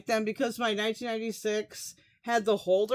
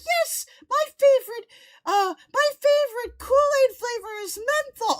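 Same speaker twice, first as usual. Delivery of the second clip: put-on high squeaky kid voice, louder; child voice; 12 semitones higher, 4 dB louder than usual. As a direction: play an eager little kid. Mocking a child who likes menthol.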